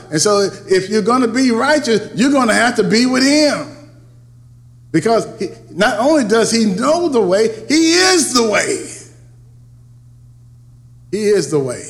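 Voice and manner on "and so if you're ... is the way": rising, louder preacher's build; full-force preaching. Acted. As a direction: preach it up to the peak, higher and louder.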